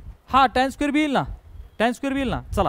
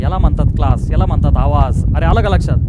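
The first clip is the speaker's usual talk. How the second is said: loud, much lower voice; booming approval; 7 semitones lower, 5 dB louder than usual. Real, not acted. Teacher hailing the class's big voice, low booming approval. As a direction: boom it out low and forceful.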